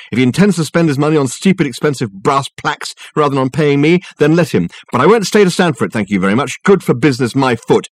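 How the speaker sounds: high, fast-starting, huffy rant; outraged employee working up to an exit; indignant secretary. Acted. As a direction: let the grievance pour out emphatically. Secretary rants before storming out.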